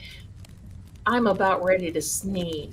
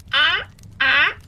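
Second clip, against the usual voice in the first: loud, higher cawing crow imitation; 5 semitones higher, 5 dB louder than usual. Shown as a demonstration. Showing the chat how a crow sounds, loud caw. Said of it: "And the crow is".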